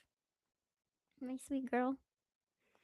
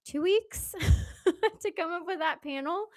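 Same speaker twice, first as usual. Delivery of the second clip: laughing; 8 semitones higher, 9 dB louder than usual. Real, not acted. Laughing at her short deadline.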